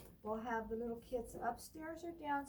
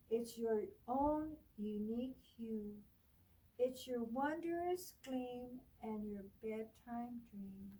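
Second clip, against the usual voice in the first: put-on reading to children; 4 dB louder, slower than usual. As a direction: read it like storytime for little ones. Librarian reads picture book aloud.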